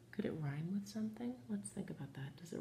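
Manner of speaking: a hushed unvoiced whisper to the class. Teacher whispering a hint to her pupils, hushed. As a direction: lean in and breathe it like a secret.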